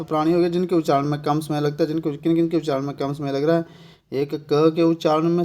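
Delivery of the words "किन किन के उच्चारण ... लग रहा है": ordinary talk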